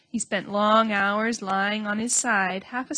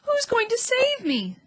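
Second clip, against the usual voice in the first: eager hope; 13 semitones higher than usual. A narrator's reading. Wilbur eager, hopeful.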